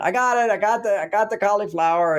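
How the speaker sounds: high, proud cry of victory; triumphant cheer. Retold or imitated. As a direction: announce it like a touchdown catch. Triumphant return with cauliflower.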